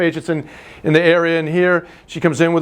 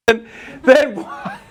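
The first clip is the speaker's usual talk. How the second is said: higher, laughing through the words; 6 semitones higher, much slower than usual. Real, not acted. Instructor cracking up while asking Tim, laughing.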